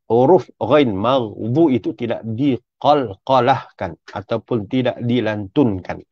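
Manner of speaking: usual speaking voice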